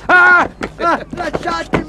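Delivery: high-pitched yelps while struggling; protesting yells. Acted. Man grabbed by others before a forced bath, protesting yells. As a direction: cry out to be let go.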